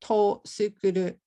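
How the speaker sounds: "Tosukuru" with a vowel pushed in after the t, to-su-ku-ru; "Tosukuru", t split off as an extra "to" syllable; extra vowel added. Shown as a demonstration. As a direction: say "Tosukuru" like a foreigner adding a vowel before su. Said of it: "I added a jama iranai boin to tsukuru".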